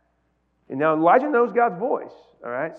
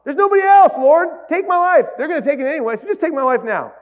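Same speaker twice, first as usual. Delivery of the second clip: high, loud desperate plea; despairing Elijah; 7 semitones higher, 6 dB louder than usual. Retold or imitated. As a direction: cry out to the Lord in despair. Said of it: "Elijah's like".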